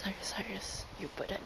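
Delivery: Cyrus Cyrus whispered softly; whispered call. Real, not acted. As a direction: whisper his name into the mic. Calling friend on mic.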